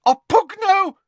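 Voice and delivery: very high, loud shrieked spell. A narrator's reading. Hermione sets the birds on Ron from the doorway, shrieking. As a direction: shriek the spell at full pitch. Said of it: "came a shriek from the doorway".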